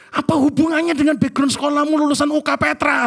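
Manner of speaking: loud, high-pitched mock outrage; imitated angrier wife. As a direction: fire it back as the exasperated wife. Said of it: "Tambah marah dia".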